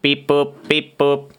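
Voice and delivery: flat robotic beeping; robot sound. Shown as a demonstration. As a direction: beep like a cheap robot. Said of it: "That's my robot sound".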